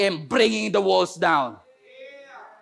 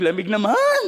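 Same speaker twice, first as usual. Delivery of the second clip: mock complaining. Preacher mimics complainers about the cold, mock whining. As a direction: grumble as if nothing suits you. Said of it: "Lahat na lang may reklamo".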